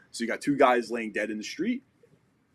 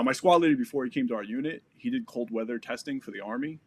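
ordinary talk

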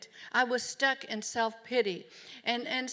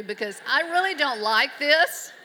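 laughing protest; 4 semitones higher, 8 dB louder than usual. Real, not acted. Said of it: "I thought".

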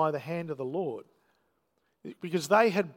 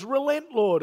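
higher, louder cry to relent; urgent plea; 7 semitones higher, 5 dB louder than usual. Retold or imitated. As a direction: call out to the Lord with urgency. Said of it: "Moses says".

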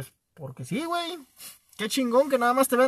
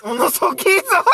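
teasing repeat; 5 semitones higher, slower than usual. Acted.

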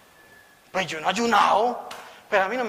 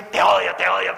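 a lightly voiced demon spitting hate; a hint of a guttural possessed voice; 2 semitones lower, 4 dB louder than usual. Possessed woman telling the preacher she hates him, lightly guttural. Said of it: "una voz diferente, gutural".

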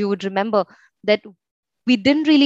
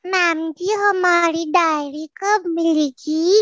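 put-on high little girl voice; 8 semitones higher than usual. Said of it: "I told my teacher".

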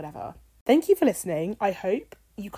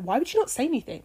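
retold exasperation; 6 semitones higher, 5 dB louder, faster than usual. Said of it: "And I was like".

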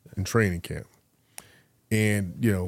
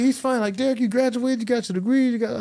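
lightly voiced advisor, much higher; a hint of a puzzled advisor; imitating the advisor; 13 semitones higher, faster than usual. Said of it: "he's like".

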